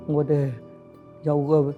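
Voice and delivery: ordinary delivery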